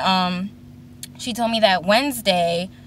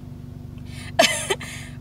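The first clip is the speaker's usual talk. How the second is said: short laugh; 6 dB quieter than usual. Real, not acted. Laughing at guy near car.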